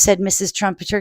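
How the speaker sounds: ordinary delivery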